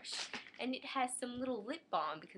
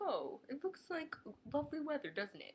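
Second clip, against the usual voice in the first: higher cheery small-talk voice; chirpy dentist; 6 semitones higher than usual. Retold or imitated. Dentist chatting about weather, lightly voiced cheery. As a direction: play the jolly dentist.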